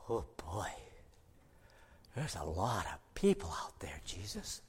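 hushed whisper to Jesus; whispered aside. Acted. Philip whispering to Jesus about the crowd, hushed. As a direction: lean in and whisper the worry.

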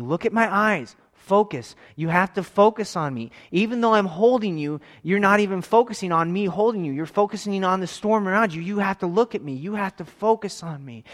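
high, loud urgent preaching; impassioned preaching. Acted. Preacher urging the congregation to focus on God, fervent. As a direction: drive the point home like a pulpit call.

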